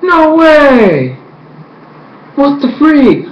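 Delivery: can't believe what he heard; disbelief. Retold or imitated. Vlogger reacting to the twist, incredulous. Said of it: "And I was like".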